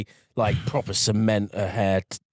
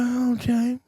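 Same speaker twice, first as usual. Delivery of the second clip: much higher, put-on voice of the Blackbird killer; murderer's voice; 12 semitones higher than usual. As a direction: lift it way up into his odd light voice. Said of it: "he talks like this".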